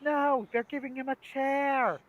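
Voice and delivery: high, astonished play-by-play; mock sports commentator. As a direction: call it like a stunned match announcer. Acts sketch's sports commentators.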